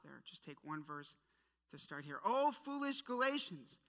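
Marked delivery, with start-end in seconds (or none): 2.2-3.8 raised, exasperated; exasperated rebuke. Acted. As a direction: scold them in disbelief. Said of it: "Can you hear it in his voice?".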